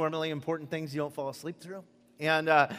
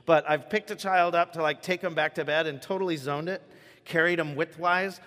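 ordinary delivery

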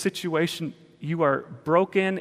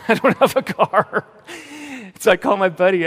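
higher, louder, through laughter; laughing; 6 semitones higher, 8 dB louder than usual. Real, not acted. Telling congregation he had no way down there, laughing. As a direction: laugh the words out at your own predicament. Laughs at his own predicament.

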